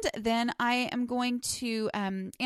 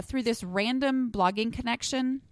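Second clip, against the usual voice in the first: ordinary delivery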